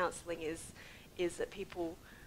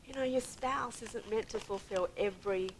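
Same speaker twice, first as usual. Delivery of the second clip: ordinary talk, nothing marked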